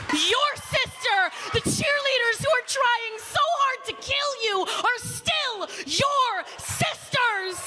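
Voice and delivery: rally every listener with rising fervour; impassioned rallying cry. Acted. Poem builds to rallying climax.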